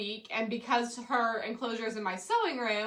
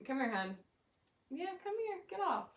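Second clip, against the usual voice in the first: soft coaxing; 5 semitones higher, 9 dB quieter than usual. Real, not acted. Coaxing the cat.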